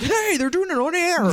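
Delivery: mock surprised stranger. Stranger reacting to address on air.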